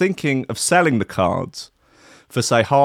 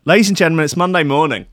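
show announcer; 4 semitones higher, 3 dB louder, faster than usual. Acted. Host opens the radio show.

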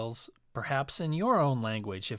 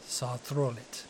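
ordinary delivery, nothing marked

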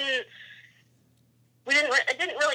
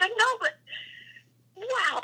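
excited; 10 semitones higher, 4 dB quieter than usual. Retold or imitated. Excited reply while shaking.

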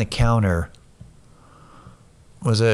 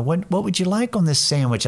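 put-on higher children's show host voice; very sweet; 7 semitones higher than usual. Counter woman asking about a sandwich, very sweet. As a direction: imitate a sweet kids' TV host offering help. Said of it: "just very sweet".

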